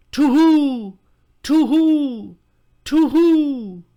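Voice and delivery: high, loud hooting owl cry; owl screech. Acted. Owl circling the lovers, screaming a shrill high to-hoo. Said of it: "three times screamed".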